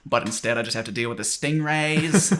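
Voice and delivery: exaggerated Australian complaint; mock Australian accent. As an Australian stuck with the stingrays, mock Australian accent. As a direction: grumble like a put-upon Australian. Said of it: "Even Australians, like, kinda just hate".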